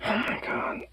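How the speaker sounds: breathy aside under the breath; whisper. Real, not acted. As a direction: whisper it in disbelief. Whispers at the dog.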